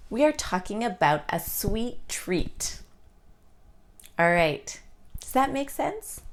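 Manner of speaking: normal talking voice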